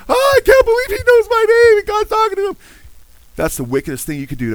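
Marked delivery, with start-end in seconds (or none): 0.1-2.6 very high, loud hysterical squeal; mock scream. Preacher imitates lady amazed at faith healer, mock screaming. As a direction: scream like an overwhelmed believer, hammed-up. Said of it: "the lady comes screaming down".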